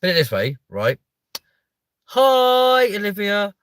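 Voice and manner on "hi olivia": high, loud, bright hello; excited greeting. Real, not acted. Streamer greeting viewer Olivia, high loud excited hi. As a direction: sing out a big hello.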